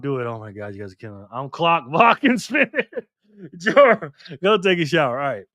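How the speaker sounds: ordinary talk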